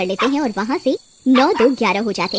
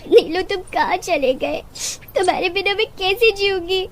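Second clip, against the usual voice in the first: higher, sobbing plea for a lost pet; acted crying, pitched up and pleading; tearful wailing; 5 semitones higher, 3 dB quieter than usual. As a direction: weep through the words, heartbroken. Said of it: "सुमन का रो रो कर बुरा हाल".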